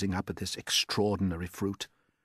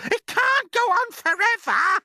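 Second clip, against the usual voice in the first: a far higher, louder aunt's shout; Aunt Spiker shouting; 9 dB louder than usual. Acted. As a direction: screech it out as the nasty aunt. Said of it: "Aunt Spiker yelled".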